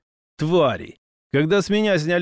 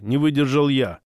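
ordinary delivery

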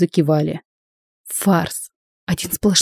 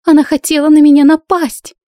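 much higher, louder shriek; staged victim's shriek; 9 semitones higher, 6 dB louder than usual. Acted. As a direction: shriek over the music to draw a crowd. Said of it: "Верещала девушка громче музыки".

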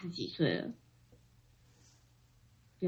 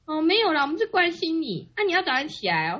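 higher granny reply; grandma's voice; 6 semitones higher, 3 dB louder than usual. Retold or imitated. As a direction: lift it into grandma's caring tone.

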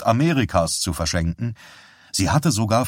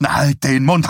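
raised, higher angry command; angry interruption; 6 semitones higher, 5 dB louder than usual. A narrator's reading. Husband cutting off his preaching wife, angry command. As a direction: cut in loudly and crossly. Husband cuts off wife's rebuke.

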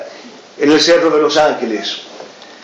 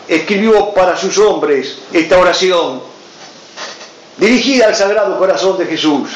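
usual speaking voice

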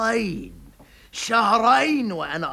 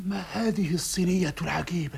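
hushed secretive whisper; 4 semitones lower, 7 dB quieter, faster than usual. Acted. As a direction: lean in and whisper it. Conspiratorial aside in scene.

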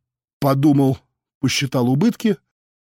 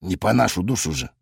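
grimacing; 6 semitones lower, 5 dB quieter than usual. A narrator's reading. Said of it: "скривился Ромыч".

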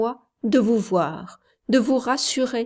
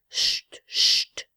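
hushing. A narrator's reading. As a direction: shush him gently under the breath. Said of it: "fit-elle". (chuchotement doux)

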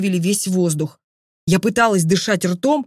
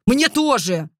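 mild narrated bark; 3 semitones higher, slower than usual. A narrator's reading. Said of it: "рявкнул".

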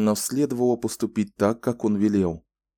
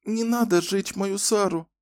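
put-on much higher, small scared child's voice; frightened little girl; 12 semitones higher than usual. A narrator's reading. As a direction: beg them to spare the doll. (испуганная девочка, высоким голосом)

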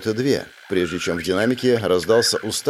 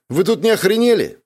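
high, loud, incredulous outrage; indignant outburst; 11 semitones higher, 4 dB louder than usual. Acted. Overheard by commanders, he blurts out high and loud in outrage.

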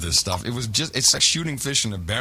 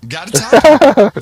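hammed-up slow bellow, much louder and higher; mock shout; 10 semitones higher, 15 dB louder, slower than usual. Topping his co-host's story with a hammed-up bellow. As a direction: belt it out like a mock challenge.

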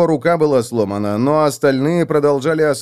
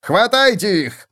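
shouted order; 9 semitones higher, slower than usual. Acted. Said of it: "закричал своим людям".

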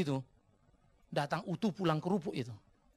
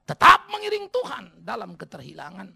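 solemn prayer with long pauses; doa; 5 dB louder than usual. Acted. Memimpin doa bersama. (doa khidmat, berjeda panjang)

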